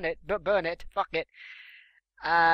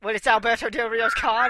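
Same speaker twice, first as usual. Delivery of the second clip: normal talking voice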